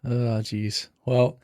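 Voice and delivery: usual speaking voice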